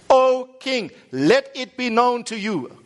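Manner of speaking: high, slow, ringing declaration; confident. Acted. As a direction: proclaim it boldly to a king. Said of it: "I can see them confidently saying".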